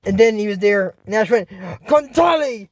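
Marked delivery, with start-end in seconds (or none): 1.5-2.7 cartoon battle call; shouted spell. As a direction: draw out the magic word as a yell.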